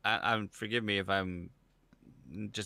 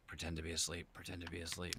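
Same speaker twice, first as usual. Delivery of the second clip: very soft, lower, under the breath; hushed self-talk; 3 semitones lower, 12 dB quieter than usual. Retold or imitated. Dad in bed avoiding the trouble, hushed self-talk. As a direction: say it low so nobody hears you're awake.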